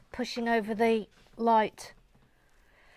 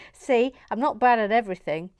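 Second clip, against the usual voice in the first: ordinary talk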